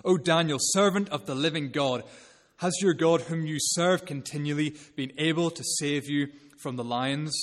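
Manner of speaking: anguished shout. Retold or imitated. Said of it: "He shouts".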